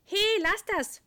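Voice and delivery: high loud cry; calling out. A narrator's reading. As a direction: shout it at the dog. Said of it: "ruft Elias".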